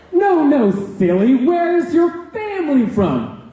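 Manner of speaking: high, gushing, sing-song wine mom voice; gushing sub. Retold or imitated. Negligent sub gushing over the student's exotic name, high and sing-song. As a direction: coo it like a clueless suburban mom. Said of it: "She goes".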